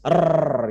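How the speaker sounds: "R" as a strong trilled Indonesian r; rolled r. Shown as a demonstration. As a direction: roll the "R" hard. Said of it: "gitu ya, mesti". (R bergetar kuat ala Indonesia)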